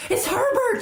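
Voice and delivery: high, loud scream of hope. A narrator's reading. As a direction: scream his name toward the door. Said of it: "she screamed".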